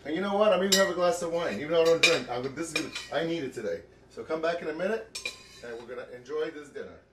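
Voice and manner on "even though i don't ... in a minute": usual speaking voice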